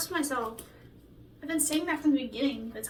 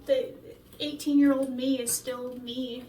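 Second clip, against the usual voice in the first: ordinary talk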